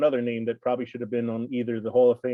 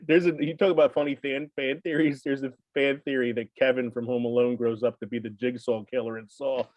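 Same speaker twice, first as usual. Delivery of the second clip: ordinary talk, nothing marked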